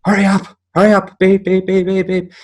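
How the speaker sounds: high, loud impatient nagging; impatient girl honking. Retold or imitated. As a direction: mimic a pushy driver beeping. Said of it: "got very impatient ... and going".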